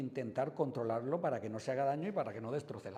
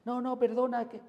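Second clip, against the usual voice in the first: much higher and louder, frantic protest; flustered driver; 12 semitones higher, 9 dB louder than usual. Retold or imitated. An upset driver arguing with the policeman, loud and high. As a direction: cut in, begging and rattled. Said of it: "tú te puedes alterar".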